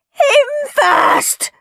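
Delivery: loud, very high scream. A narrator's reading. Henry points at Peter, screaming. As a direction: shriek to shift the blame. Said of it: "screamed Henry, pointing at Peter".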